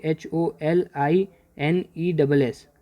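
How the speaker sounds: slow letter-by-letter spelling of holiness; name each letter of holiness slowly and clearly; spelled out. Shown as a demonstration. Teacher spelling holiness for the class, slow and letter by letter. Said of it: "holiness की spelling क्या होती है".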